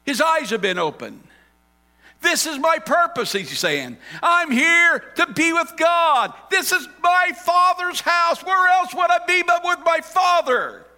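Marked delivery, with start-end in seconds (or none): 2.2-3.3 joyful declaration. Acted. Jesus declaring his purpose. 4.2-10.8 high, loud, slow proclamation; boy announcing his calling with joy; joyful declaration. Acted. Jesus declaring his purpose.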